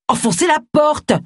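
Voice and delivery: high, urgent command; insistent, high-pitched order; urging. A narrator's reading. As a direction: press them to act, pitch jumping up. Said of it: "le pressa Agatha".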